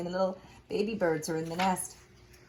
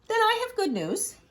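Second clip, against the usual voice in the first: Piggy voice; 13 semitones higher than usual. Acted.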